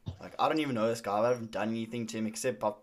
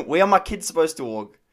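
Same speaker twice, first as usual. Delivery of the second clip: imitating an indignant father, raised and louder; retold angry demand; 4 semitones higher, 6 dB louder than usual. Angry father challenges pizza driver about kids, retold angry demand. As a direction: throw out the question in mock anger. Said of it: "he's angry now".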